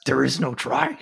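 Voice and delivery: a hint of Yoda doing the wise master line; Yoda impression. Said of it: "How's my Yoda?".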